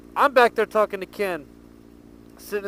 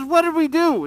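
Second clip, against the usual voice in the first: high, louder mock-innocent protest; higher, louder voice for a caught girl playing dumb; indignant girl; 9 semitones higher, 6 dB louder than usual.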